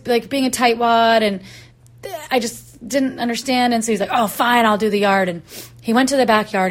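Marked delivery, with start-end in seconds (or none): 4.1-5.3 louder, huffy retold surrender. Rake guy giving in on the yard, huffy. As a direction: give in with a huff, imitating him. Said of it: "he was, like, pretty huffy about it".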